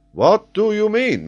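high, loud challenge; indignant exclamation. A narrator's reading. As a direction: burst out, affronted. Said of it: "exclaimed Paganel".

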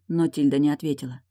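plain reading voice

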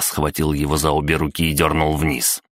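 usual speaking voice

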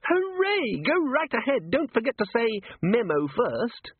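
a tiny eager imp reading the schedule; Dis-organizer imp announcing the wake-up call; chirpy imp. Acted.

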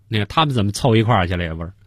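normal talking voice

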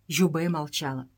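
ordinary delivery

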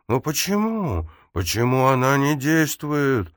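high, slow, pleading disbelief; desperate disbelief. A narrator's reading. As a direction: cry out in desperate confusion. Martin baffled and desperate.

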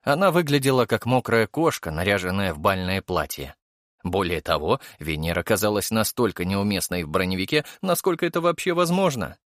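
plain reading voice

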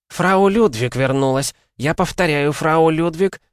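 high, loud announcement on phone; excited old man. A narrator's reading. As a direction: call it out, relieved. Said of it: "удивление и облегчение".

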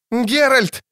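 high-pitched squeal of a call; shrieking. A narrator's reading. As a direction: shriek the name in alarm. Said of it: "верещал Лютик". (пронзительно верещит)

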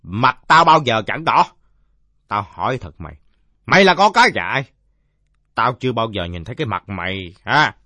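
loud challenges dropping into suspicious questioning; indignant drunkard. Acted. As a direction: grill the kid like a touchy drunk. (giọng ông say gắt gỏng tra hỏi)

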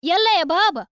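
high, loud call to dad. A narrator's reading. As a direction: call him over from afar. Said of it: "مناديتان والدهما".

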